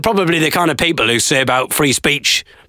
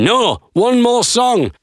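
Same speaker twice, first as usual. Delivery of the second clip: mock crowd shout; 10 semitones higher, much slower than usual. Audience calls the comedian back, high mock shout. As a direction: imitate an audience calling out, high and stretched. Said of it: "you can hear them shouting".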